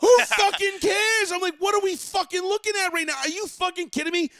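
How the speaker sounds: high, loud disbelieving rant; indignant rant. Real, not acted. Host ranting to cohost about the review, loud and high. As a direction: vent at the absurd review.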